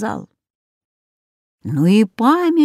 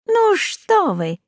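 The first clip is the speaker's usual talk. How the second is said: objecting; 11 semitones higher, slower than usual. Acted. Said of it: "возразил Жако".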